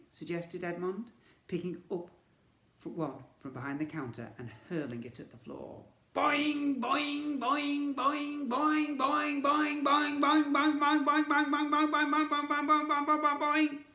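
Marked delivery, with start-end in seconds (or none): 6.1-13.8 high loud boing repeated; bouncing ball noise. A narrator's reading. Narrator voicing the endlessly bouncing ball.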